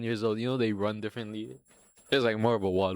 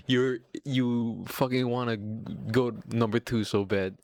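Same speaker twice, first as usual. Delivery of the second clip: ordinary delivery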